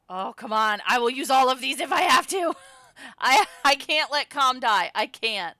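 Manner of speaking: loud, high, laughing pleading; desperate outburst. Real, not acted. Player desperate to save Calm, loud pleading. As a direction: plead loudly, half laughing.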